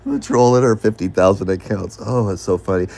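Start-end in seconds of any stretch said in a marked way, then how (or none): none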